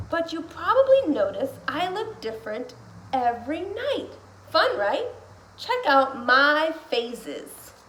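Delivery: put-on animated read-aloud of a kids' book; reading to children. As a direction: read it to kids with big bouncy energy. Reads picture book aloud.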